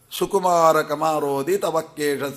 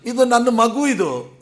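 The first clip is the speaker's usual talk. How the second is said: put-on a higher-pitched doting father saying his child is still a baby; lightly voiced affectionate father; fond father; 7 semitones higher than usual. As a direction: sound like a proud father pointing to his little one. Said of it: "ತಂದೆ ಹೇಳ್ತಾನಂದ್ರೆ".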